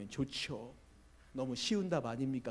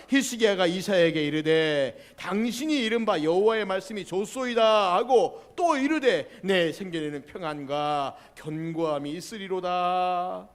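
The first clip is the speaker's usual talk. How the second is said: loud scripture reading; 3 semitones higher, 13 dB louder, slower than usual. Real, not acted. Pastor leads the church reading Isaiah aloud, loud and slow. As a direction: read the verse aloud with the congregation.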